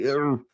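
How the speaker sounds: a reluctant, drawn-out groan; a short, put-upon ugh; groan of dread. Retold or imitated. To a colleague asking about the task, a reluctant groan. His reaction to the request.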